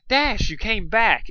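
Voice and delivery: slow, loud, high joyful cry; happy exclaim. A narrator's reading. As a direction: greet her with delight. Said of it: "exclaimed with happiness".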